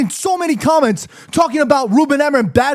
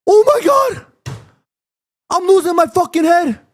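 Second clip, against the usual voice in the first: high, exasperated shout; heated; 4 semitones higher than usual. Real, not acted. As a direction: cry out at your wits' end. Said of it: "I got a little heated".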